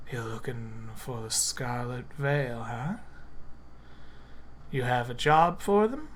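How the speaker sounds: soft, conspiratorial murmur; low voice. Acted. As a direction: lean in and keep it secret. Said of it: "in a low voice".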